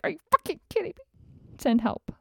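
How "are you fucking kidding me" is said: exasperated outburst. Retold or imitated. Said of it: "It's like".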